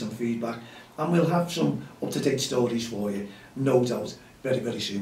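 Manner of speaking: loud, upbeat radio host voice; radio sign-off. Acted. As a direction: wrap up the show like a presenter.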